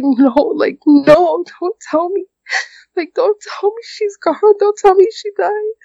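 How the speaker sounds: high, pleading cry of dread; begging don't tell me, voice climbing high; breaking down. Retold or imitated. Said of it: "that's when I just broke down".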